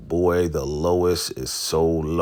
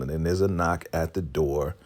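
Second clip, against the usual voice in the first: plain reading voice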